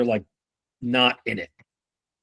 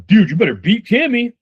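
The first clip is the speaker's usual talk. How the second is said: mock coach yelling, much higher and louder; hyped-up pep talk; 11 semitones higher, 4 dB louder than usual. Manager hammering a rep about Tammy, mock-shouted higher and louder. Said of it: "What I'm not doing here is I'm going".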